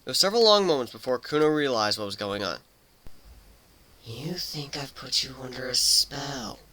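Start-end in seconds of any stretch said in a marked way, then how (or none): 4.0-6.6 slow, breathy whisper of a realization; a quiet, unvoiced murmur; murmured quietly. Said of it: "Kuno murmured quietly".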